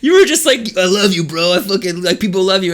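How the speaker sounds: mock the helper's pep talk while cracking up; laughing mock quote. Driver retelling the helper's speech, laughing and incredulous.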